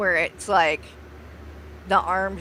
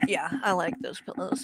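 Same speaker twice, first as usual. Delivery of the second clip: whispered aside; hushed whispered afterthought; 6 dB quieter than usual. Real, not acted. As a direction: whisper it to yourself. Aside about pillows.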